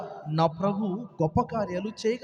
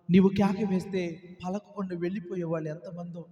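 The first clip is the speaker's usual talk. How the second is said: ordinary delivery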